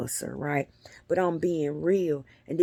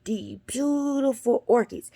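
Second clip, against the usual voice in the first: ordinary delivery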